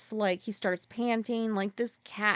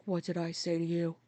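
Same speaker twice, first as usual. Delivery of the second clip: stern quiet; 4 semitones lower, 6 dB quieter than usual. Retold or imitated. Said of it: "I glare at her".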